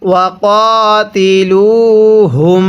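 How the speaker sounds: slow drawn-out ওয়াকাতিলুহুম, each syllable stretched; measured Quranic reading of ওয়াকাতিলুহুম; Quran recitation. Shown as a demonstration. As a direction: recite ওয়াকাতিলুহুম slowly with a long drawn vowel. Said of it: "তাহলে কিভাবে পড়ব?".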